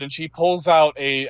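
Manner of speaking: normal talking voice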